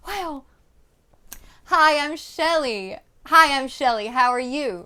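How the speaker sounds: Christmas-morning excitement, kept in check; the right way: excited but contained. Shown as a demonstration. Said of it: "you're so excited".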